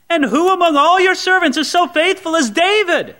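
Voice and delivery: high, earnest, pleading defense; innocent priest. Acted. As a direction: protest your innocence sincerely. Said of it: "It's so innocent".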